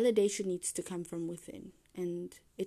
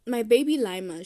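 excited; 4 semitones higher, 12 dB louder than usual. Real, not acted. Loud affectionate exclamation.

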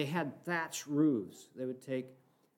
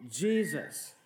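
preaching at full force; 7 semitones higher, slower than usual. Acted. Preacher exhorting brothers and sisters, fervent preaching. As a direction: rouse the church to look to Jesus. Leads a preaching call to congregation.

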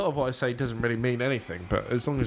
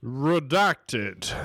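louder, flat censor stamp voice; robotic redacted; 3 semitones higher, 3 dB louder than usual. Friend censors his own surname, louder stamp-like voice. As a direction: announce it like a censor bleep. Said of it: "I want you to say redacted over it".

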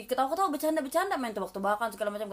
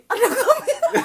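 high, loud burst through laughter; real laughing fit, words stretched; 8 dB louder, slower than usual. Real, not acted. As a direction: crack up while saying it. Laughing over suspected prank.

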